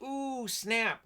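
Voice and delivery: high, sassy ooh snap; high playful taunt; mock sassy ooh snap. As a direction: squeal it like a gossiping teen. Mock crowd reacting to insult.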